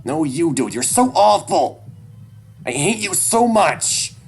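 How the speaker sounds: put-on very high, loud kid's tirade; high-pitched, distraught outburst; anguished screaming. A narrator's reading. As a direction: scream all your hurt at your father. Child's tearful tirade at father.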